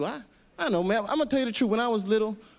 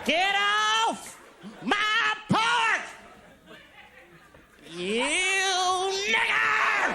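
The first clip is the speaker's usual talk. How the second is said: put-on high yelling old man off the porch; shouting at trespasser; 8 semitones higher, much slower than usual. Shown as a demonstration. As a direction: bark it at a trespasser. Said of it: "try it out in a sentence first".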